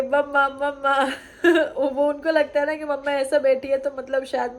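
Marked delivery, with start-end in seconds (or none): 0.0-1.0 mildly raised mock child's yell; light imitation of a child calling out; imitating calling child. Mother imitates her son calling for mamma, mock yell. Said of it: "चिल्लाते रहेंगे".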